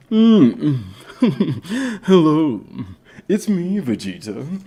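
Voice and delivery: put-on anime voice with chuckle; hum, chuckle, then greet in character. Streamer greeting Vegeta, playful anime villain voice. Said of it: "I can do the Japanese Goku voice".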